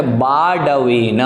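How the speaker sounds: बाडवेन with a clear b sound, not v; correct pronunciation. Shown as a demonstration. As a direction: open बाडवेन on बा. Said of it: "आप वा की जगह बा पढ़ें।".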